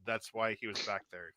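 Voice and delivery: usual speaking voice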